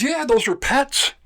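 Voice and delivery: high, slow and innocent, like it's obvious; fisherman's voice. Retold or imitated. Fisherman tells the warden the trout are pets, high and slow.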